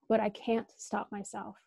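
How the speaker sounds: ordinary talk, nothing marked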